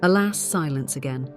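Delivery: ordinary talk, nothing marked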